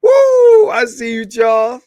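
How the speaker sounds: high, loud whoop of greeting; excited whoop. Real, not acted. Streamer whooping at chat viewer Charles, excited.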